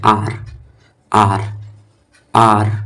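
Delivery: "आर" with a long open "आ"; A read "आ". Shown as a demonstration. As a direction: repeat "आर" as separate model words. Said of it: "अ को आ भी पढ़ते हैं".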